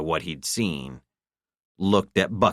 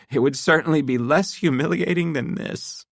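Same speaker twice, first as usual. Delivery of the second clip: holding back tears; 10 semitones higher than usual. A narrator's reading. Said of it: "to catch a tear".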